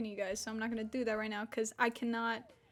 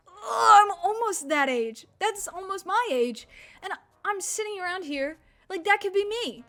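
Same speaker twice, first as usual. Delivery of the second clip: high-pitched, dramatized dawning realization; retold realization; 6 semitones higher, 7 dB louder than usual. Streamer retelling watching others stream, high mock-dramatic realization. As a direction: act out a sudden hopeful outburst, louder at the start. Acting out own reaction to streamers.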